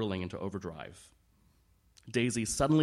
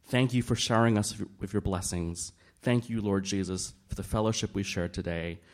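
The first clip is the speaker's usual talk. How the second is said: praying aloud; 5 dB louder than usual. A narrator's reading. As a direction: lift it up to the Lord. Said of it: "began to pray out loud".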